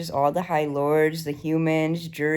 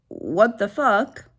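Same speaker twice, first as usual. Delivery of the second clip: drawn-out disbelief; 5 semitones higher, slower than usual. Real, not acted. Reacting to Rhys transforming.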